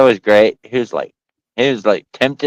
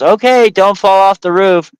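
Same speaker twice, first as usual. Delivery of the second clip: higher, louder mock warning voice; put-on girlfriend voice, pitched up; imitated girlfriend; 9 semitones higher, 5 dB louder than usual. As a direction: call out a teasing caution.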